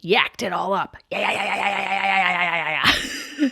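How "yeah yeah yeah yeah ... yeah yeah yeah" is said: mock retching. Imitating vomiting over toilet.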